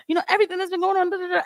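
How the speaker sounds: mock hysteria. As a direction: rattle it off like she's losing it. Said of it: "I can hear the hysteria".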